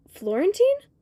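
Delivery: high, sharply rising question; questioning call. A narrator's reading. Child spots Florentine on the bench, high questioning call. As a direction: call the name out as a surprised question.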